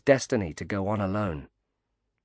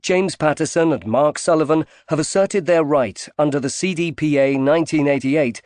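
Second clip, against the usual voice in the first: louder, higher formal credits read; credits announcement; 6 semitones higher, 8 dB louder than usual. Acted. Audiobook closing credits read to listeners, formal announcer. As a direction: announce the copyright notice crisply.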